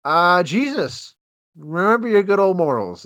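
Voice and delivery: high, loud, drawn-out parody; mock moralizing. Host mocking the movie's ending, hammed-up moral lesson. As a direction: preach it in hammed-up fashion. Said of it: "the ending of this movie is like".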